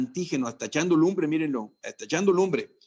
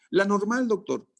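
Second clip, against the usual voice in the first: student answering; 6 semitones higher than usual. Acted.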